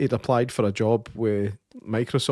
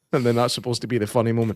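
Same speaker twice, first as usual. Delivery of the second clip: usual speaking voice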